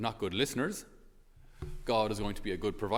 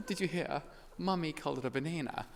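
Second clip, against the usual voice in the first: put-on high, giggly British accent; laughing, high-pitched Oxford English; gleeful British child; 7 semitones higher than usual. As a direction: imitate a posh kid delightedly telling on mom. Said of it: "she plays dumb in order to get her mom to say things".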